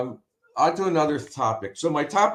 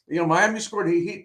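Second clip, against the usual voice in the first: ordinary delivery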